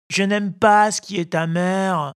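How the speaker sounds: put-on high whining puppet boy; whiny child. A narrator's reading. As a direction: whine and refuse the medicine. Whiny Pinocchio refuses. (voix d'enfant pleurnicheur)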